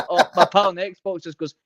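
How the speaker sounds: usual speaking voice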